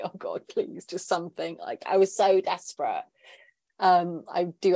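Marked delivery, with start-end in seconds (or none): 0.0-1.6 whispered plea. Retold or imitated. Desperate plea on a teary run.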